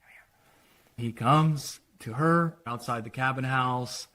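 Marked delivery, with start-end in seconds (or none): 0.0-1.1 a hushed call in the dark; hushed whisper. Retold or imitated. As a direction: whisper it, secretive. Secret meeting in night woods.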